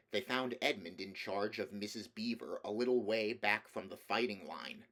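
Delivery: ordinary delivery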